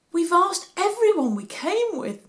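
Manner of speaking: high, pleading, near tears; lightly voiced weeping mother; tearful mother. A narrator's reading. Mary tells Joseph nobody has seen him, near tears. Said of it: "Mary wept".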